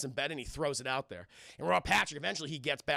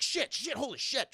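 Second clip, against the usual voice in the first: high-pitched panicked cursing; mock panic; 6 semitones higher than usual. Man in elevator being stabbed at from the top, mock panic. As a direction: imitate frantic swearing under attack.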